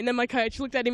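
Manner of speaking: ordinary delivery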